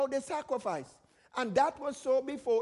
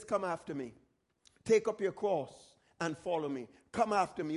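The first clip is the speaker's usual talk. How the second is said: gentle Jesus voice; 6 semitones lower than usual. Retold or imitated. Jesus calling disciples, quoted.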